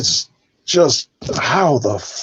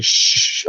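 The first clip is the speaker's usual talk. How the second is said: drawn-out audience shush; imitated shushing. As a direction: hush someone like an annoyed moviegoer. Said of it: "people are like".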